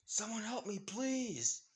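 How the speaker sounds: high-pitched acted cry for help; yelling for help. A narrator's reading. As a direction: call out high, without real volume. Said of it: "she hears someone yelling out".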